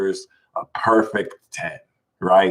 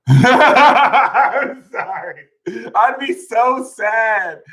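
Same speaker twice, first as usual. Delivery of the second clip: laughing; 11 semitones higher, 4 dB louder, much slower than usual. Real, not acted. Laughing at his own line.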